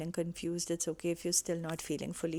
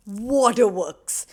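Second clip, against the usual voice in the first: loud, slow punchline; emphatic burst; 4 semitones higher, 12 dB louder, slower than usual. Real, not acted. Telling viewers how she'd burst into tears, loud and drawn out. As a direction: land it big and drawn out.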